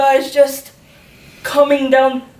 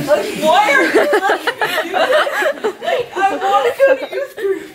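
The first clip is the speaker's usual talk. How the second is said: laughing complaint; 7 semitones higher than usual. Retold or imitated.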